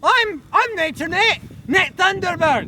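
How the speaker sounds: high, slow, emphatic announcement; indignant self-introduction. Acted. Nature host introducing himself to his rival, indignant. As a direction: declare your name to a rival. Acted quarrel over the show.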